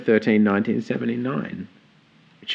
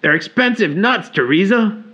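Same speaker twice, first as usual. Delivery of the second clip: higher, louder Jersey-flavored quote; Jersey accent; 9 semitones higher, 5 dB louder than usual. Retold or imitated. Co-host quoting a sitcom line in a Jersey voice. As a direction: toss off the line in a mild New York accent. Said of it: "mine's just a short one today".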